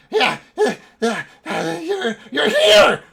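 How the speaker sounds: high, halting cry building to a shout; stammering, then shrieked elation; overwhelmed mad elf. Acted. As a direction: burst out with frantic emotion. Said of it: "lots of emotion packed behind this".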